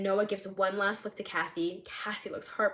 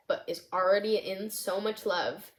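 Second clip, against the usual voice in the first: usual speaking voice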